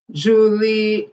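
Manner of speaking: ordinary talk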